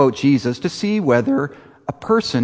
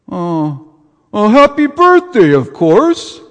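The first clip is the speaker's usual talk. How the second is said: loud Brooklyn Santa bluffing; a hint of a Brooklyn Santa; Brooklyn accent; 8 semitones higher, 6 dB louder than usual. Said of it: "a Santa with kind of a Brooklyn accent".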